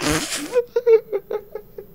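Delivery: high laugh breaking the character; breaks into a laugh. Acted. Comedian breaks character laughing mid-impression, high laugh. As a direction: crack up mid-line.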